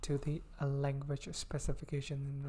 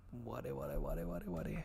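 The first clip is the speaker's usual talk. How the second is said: "ware" chanted much lower and softer; deep put-on voice; 9 semitones lower, 6 dB quieter than usual. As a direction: drop way down into a low, soft mock monster drone.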